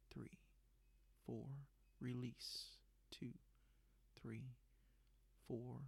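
calm, unhurried breathing count; slow breath counting. Acted. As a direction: count the breaths slowly and softly.

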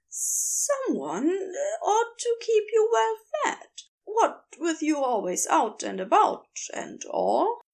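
an anxious angel trying to be polite; nervous Aziraphale. Acted. Aziraphale voiced, fussy and nervous.

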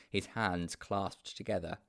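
usual speaking voice

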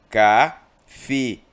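Cafeína drawn out slowly as ca-fe-í-na; syllable split. Shown as a demonstration. Teacher separates Cafeína into syllables for class, slowly. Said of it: "Quando eu faço a separação da palavra cafeína".

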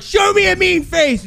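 high, intense mock coach demand; acting out a yell in her face; retold yelling. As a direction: push it high and fierce. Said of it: "And I, like, yelled at, in her face".

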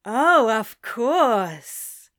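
drawn-out insider tone; conspiratorial. A narrator's reading. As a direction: smile as if sharing a secret. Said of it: "conspiratorial smile".

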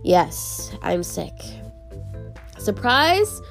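ordinary talk

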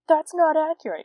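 high, loud whiny know-it-all; mocking a nitpicking fencer. Mocking the fencers who will complain, in a high loud whine. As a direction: sneer it like a pedant correcting you. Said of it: "don't come after me if you actually fence".

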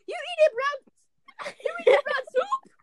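very high, drawn-out mock yell; retold outcry. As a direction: imitate a squealed, incredulous outcry, stretched out. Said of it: "And he goes".

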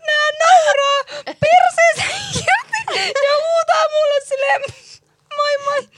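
high, laughing through the words; telling it while cracking up; high-pitched laughing retelling; laughing hard. Real, not acted. Retelling friends laughing at her.

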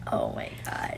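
whispered oh my God in sympathy; breathy whisper. Real, not acted. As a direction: breathe it out, unvoiced. Reacting with sympathy.

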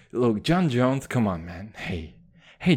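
ordinary delivery

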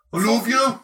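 high, hammed-up Brummie; Birmingham accent. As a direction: declare love in an abrasive Birmingham twang. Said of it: "Birmingham's another weird one".

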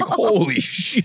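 high, slow mock shock; stunned kids' reaction. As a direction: stretch it out in high, retold disbelief. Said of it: "We're like".

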